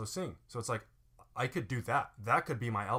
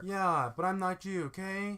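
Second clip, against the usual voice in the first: high, stretched-out bratty whine; irritating impatient "okay?"; annoying tone; 8 semitones higher, slower than usual. Pretend. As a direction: drag it out like a petulant kid. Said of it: "what an annoying, like, tone of voice".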